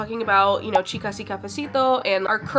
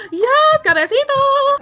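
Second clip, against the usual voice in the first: thrilled squeal; 7 dB louder, slower than usual. Real, not acted. Boyfriend's surprise gift.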